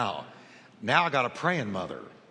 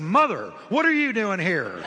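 startled, louder and higher call out; surprised exclamation; 5 semitones higher, 6 dB louder than usual. Retold or imitated. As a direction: caught off guard, blurting it into the mic. Caught mother at altar, mic hot.

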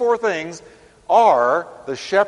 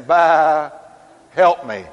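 mock cry for help; 6 dB louder, much slower than usual. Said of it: "You'll be saying".

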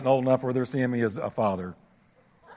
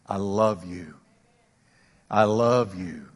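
softer and lower, slow and tender; tender assurance; 7 semitones lower, 3 dB quieter, slower than usual. Acted. Preacher voicing God telling his people, soft and tender. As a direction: say it like a loving father. God's words to listeners.

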